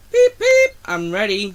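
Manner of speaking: very high, loud beep then a brighter I'm ready; small engine announcing it's ready with a loud high beep; eager toot. Acted. Little engine toots eagerly.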